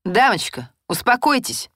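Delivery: по-военному строгий окрик; рявкнуть команду, громче; строго гаркнул. A narrator's reading. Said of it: "по-военному строго гаркнул сержант". (резкий командный окрик)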